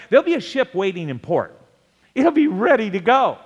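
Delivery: high, driving sermon delivery; urgent pulpit exhortation; impassioned preaching. Real, not acted. Preaches at full force.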